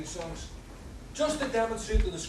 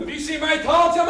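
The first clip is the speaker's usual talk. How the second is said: loud heckle; 10 semitones higher, 12 dB louder than usual. Acted. Punter heckles the caller.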